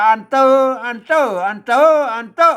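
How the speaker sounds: "เตอ" high and loud, its tone varied each time; alternative tone readings. Shown as a demonstration. Teacher offering learners any reading, เตอ loud in varying tones. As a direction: stress "เตอ" loudly, trying one tone after another. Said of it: "สุดแล้วแต่ท่านจะอ่าน".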